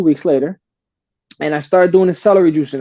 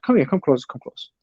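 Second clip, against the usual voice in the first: softer, a quiet come-here to the doctor; hushed beckoning; 3 semitones lower, 6 dB quieter than usual. Retold or imitated. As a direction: drop it down, pulling the doctor in close. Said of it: "I told the doc".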